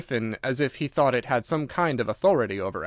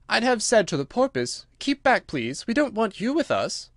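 put-on higher girl's voice, firm; Alice, indignant; 9 semitones higher than usual. Alice imagines telling off the porpoise, high and firm. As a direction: shoo someone off in a lifted voice.

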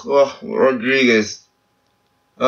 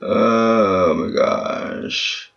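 very slow, stretched oh my gosh; drawn-out despair; 3 semitones lower, much slower than usual. Real, not acted. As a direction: sigh it out in disbelief. Frustrated at bad pack.

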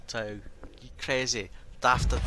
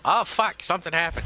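sudden jump up, louder and higher; startled curse; 5 semitones higher, 6 dB louder than usual. Real, not acted. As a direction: caught off guard by the game. Game surprise mid-sentence.

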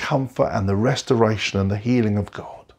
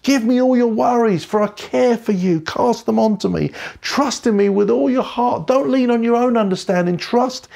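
high, gentle promise of never leaving; God comforting; 8 semitones higher than usual. Retold or imitated. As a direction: hold them close with God's tender words. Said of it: "He's whispering".

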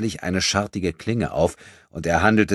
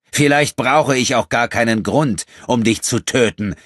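higher, loud cutting threat; sharp tone; 7 semitones higher, 8 dB louder than usual. Acted. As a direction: cut him with the threat. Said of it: "in unverändert scharfem Ton". (scharfer, lauter Ton)

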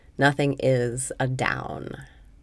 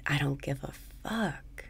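"I don't give a fuck" whispered softly, breathy and unvoiced; whispered mantra; 6 dB quieter than usual. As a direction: breathe the words out as a quiet whisper.

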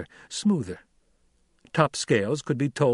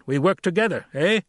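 raised, hearty voice pressing the point; Stilgar urging teamwork; 7 semitones higher than usual. A narrator's reading. Stilgar slapping Paul's shoulder, hearty. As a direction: press it home as the leader.

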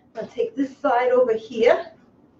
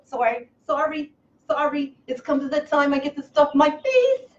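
ordinary talk

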